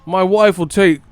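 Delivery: high, loud, slow goofy voice; mock clueless customer. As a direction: ham up a guy ordering for his wife. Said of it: "He's like".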